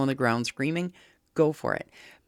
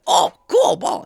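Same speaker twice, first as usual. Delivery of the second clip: much higher, louder goofy dog voice; excited dog voice; 9 dB louder than usual. Retold or imitated. Pit bull dropping Copper for the ball, goofy excited dog voice. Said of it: "the pit bull went".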